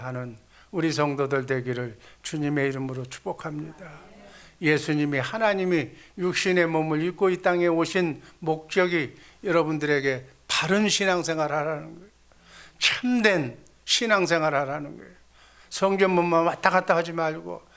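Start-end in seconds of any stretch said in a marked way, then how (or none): none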